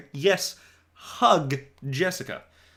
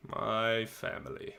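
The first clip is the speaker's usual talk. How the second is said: softer and slow, dreading the words; quiet dread; 4 semitones lower, 11 dB quieter than usual. Shown as a demonstration. Boy dreading telling listeners about family, softer and slow. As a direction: mutter it like the hard part. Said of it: "the hard part".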